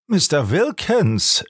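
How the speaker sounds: high, drawn-out cry of shock; frightened cry. A narrator's reading. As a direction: call the name in alarm. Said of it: "schrie Robert erschreckt".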